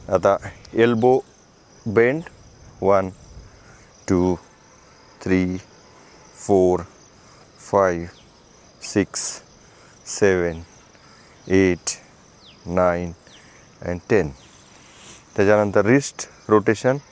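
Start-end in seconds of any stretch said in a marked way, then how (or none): 2.8-14.3 low, level rep count; exercise counting. Acted. Instructor counting shoulder rotations, steady low count. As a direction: count evenly, one word per movement.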